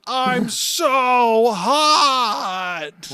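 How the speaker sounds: high, drawn-out whine; whiny Luffy. Retold or imitated. Voicing Luffy whining he's hot. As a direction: stretch it into a wail. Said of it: "Luffy's like".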